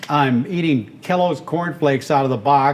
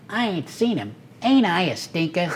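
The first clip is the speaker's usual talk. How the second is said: cartoon rabbit; 4 semitones higher, 3 dB quieter than usual.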